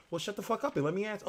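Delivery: a muffled mock outburst, fast; hushed fast snap. Host snaps at viewer again.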